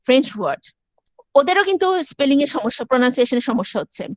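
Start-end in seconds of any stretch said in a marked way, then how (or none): none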